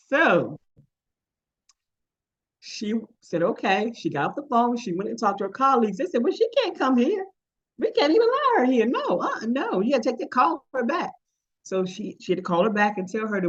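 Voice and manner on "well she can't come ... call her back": high-pitched mock outrage, swooping up and down; retold, exaggerated indignant objection; indignant refusal. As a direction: play scandalized colleagues laying down the law. Colleagues refusing in retold story.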